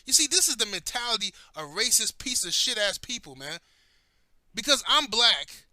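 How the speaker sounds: ordinary talk, nothing marked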